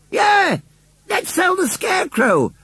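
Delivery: put-on very high childlike shout; clamouring children. A narrator's reading. Little children cheer on the sale, clamouring. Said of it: "clamoured all the little children".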